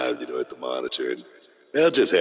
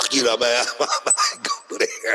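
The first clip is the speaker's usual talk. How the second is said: squealing high laugh; 9 semitones higher, slower than usual. Shown as a demonstration. Host showing how his octave goes up, squealing high. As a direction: shoot the laugh way up. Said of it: "sometimes my octave go up".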